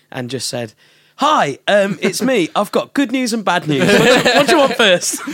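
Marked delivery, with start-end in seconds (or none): 1.2-5.2 higher-pitched, perky retold phone greeting; phone call setup. Musician retelling a prank phone call, raised perky voice. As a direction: put on a bright, loud phone voice.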